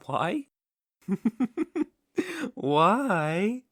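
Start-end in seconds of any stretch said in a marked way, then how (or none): 1.1-1.8 mocking laugh.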